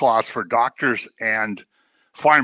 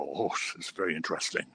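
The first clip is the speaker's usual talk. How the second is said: mock interest; 8 semitones lower, 15 dB quieter than usual. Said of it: "people on C N N go".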